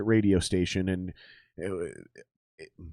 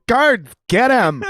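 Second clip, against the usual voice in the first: slow, loud, much higher order; hammed-up royal command; 11 semitones higher, 7 dB louder, slower than usual. Retelling the joke, louder slow royal command. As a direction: bellow for the guards like a pompous king.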